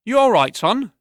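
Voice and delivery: put-on a working man calling over to a pale boy; concerned barkeep. Barkeep asks a swaying boy if he's all right, concerned.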